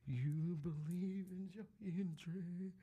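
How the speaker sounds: entrance announcer voice. Retold or imitated. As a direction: croon it like an entrance theme. Mock entrance call.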